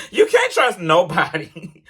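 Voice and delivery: laughing through the words, slow. Real, not acted. Driver laughing about workplace distrust, slow and laughing. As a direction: chuckle it out slowly, disbelieving.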